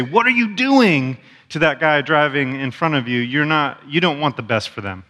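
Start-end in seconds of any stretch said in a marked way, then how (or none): 0.0-1.2 high, slow exasperated shout at a driver; what are you doing, pitched up in annoyance; road rage yell. Shown as a demonstration. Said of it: "When you say".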